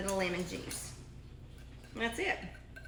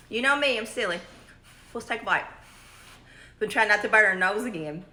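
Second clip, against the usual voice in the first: ordinary talk, nothing marked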